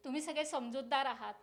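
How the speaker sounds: ordinary delivery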